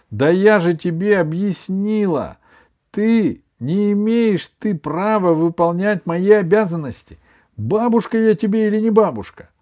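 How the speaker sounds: high, loud indignant scolding; flaring up. A narrator's reading. Grandmother scolding grandson for doing her duties, flaring up. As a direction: grandmother losing her temper. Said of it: "вспылила бабушка".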